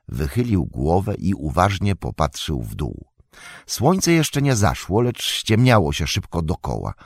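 plain reading voice

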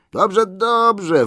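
high, loud brushing-off call. A narrator's reading. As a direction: placate the butler and get going. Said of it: "wołali".

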